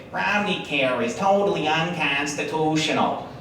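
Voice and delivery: put-on a light Wisconsin accent, slow; mildly imitating Bachmann in a Wisconsin accent. Speaker mimics Bachmann's claim in a light, slow Wisconsin accent. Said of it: "so I can do that accent".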